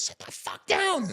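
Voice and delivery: mock shriek, high pitched; imitating a scream. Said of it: "she screams, like, I've never heard her scream".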